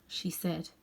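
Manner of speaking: ordinary delivery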